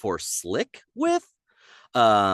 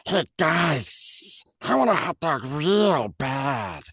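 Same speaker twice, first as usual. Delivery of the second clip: high, slow creature whine; Gollum-Coolidge voice; 5 semitones higher, much slower than usual. Shown as a demonstration. Lizard brother asks guys for a hot dog, high and slow. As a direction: plead for the hot dog lazily. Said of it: "no S in that sentence".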